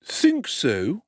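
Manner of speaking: a lightly voiced doubtful undertaker; half doubting. A narrator's reading. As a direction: test the idea with a questioning lift. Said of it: "in a tone which half admitted and half disputed".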